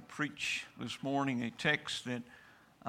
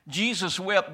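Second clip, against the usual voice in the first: higher and louder, ringing out; loud proclaiming; 5 semitones higher, 6 dB louder than usual. Acted. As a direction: proclaim it to the whole church. Repeats verse at full force.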